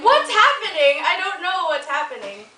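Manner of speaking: mock panic. Dad panicking at the ghost.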